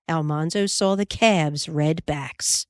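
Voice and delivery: ordinary delivery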